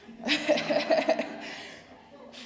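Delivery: high breathy laugh; a quick embarrassed laugh; light laugh. Real, not acted. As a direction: let a short airy laugh slip out. Laugh after whispered aside.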